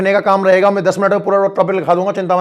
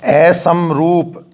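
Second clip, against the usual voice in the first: slow dictation; 2 semitones lower, much slower than usual. Shown as a demonstration. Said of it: "वो बनता है असमरूप".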